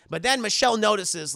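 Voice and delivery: normal talking voice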